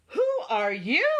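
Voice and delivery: high, loud astonished question; gasped. A narrator's reading. Everyone seeing the creature on the doorstep, astonished. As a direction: ask it in amazement. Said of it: "everyone gasped".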